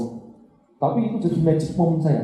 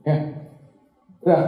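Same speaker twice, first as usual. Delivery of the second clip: ordinary talk, nothing marked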